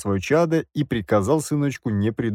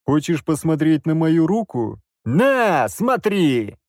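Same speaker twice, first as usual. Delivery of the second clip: louder, higher, taunting challenge; defiant challenge; 5 semitones higher, 3 dB louder, slower than usual. A narrator's reading. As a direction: throw it in his face. Narrator confronting the father.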